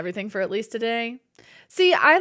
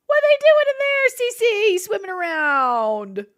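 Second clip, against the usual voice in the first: high, loud, slow silly voice; playful silly voice; 11 semitones higher, 7 dB louder, slower than usual. Acted. Voicing the pillagers for chat, silly high voice. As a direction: squeak it out playfully.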